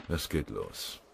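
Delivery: tiefer und leiser, resigniert; trübe. A narrator's reading. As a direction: ohne Hoffnung sagen. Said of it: "Ettlacher nickte trübe". (Ettlacher bestätigt den Kameraden trübe, gedämpft)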